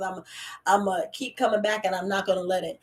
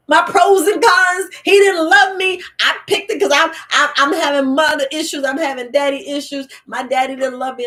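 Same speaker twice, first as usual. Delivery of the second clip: mock sobbing; 5 semitones higher, 7 dB louder than usual. Mocking a heartbroken viewer, hammed-up sobbing. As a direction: wail it like a jilted lover, playing it up.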